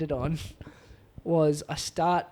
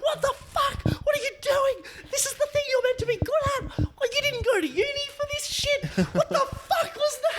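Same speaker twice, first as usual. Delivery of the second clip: much higher, wailing half-laughing sob; crying in despair. Retold or imitated. Comic alone in his car after bombing, high wailing sob. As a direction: cry it out in a high broken voice, laughing at yourself. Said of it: "I sat in my car and I cried".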